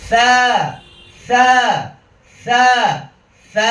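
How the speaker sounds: airy drilled "tha", last one long; gap left open. Shown as a demonstration. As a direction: leave space under the tongue for "সা". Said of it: "ফাঁকা থাকবে".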